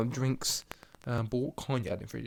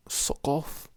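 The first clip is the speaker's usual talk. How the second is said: usual speaking voice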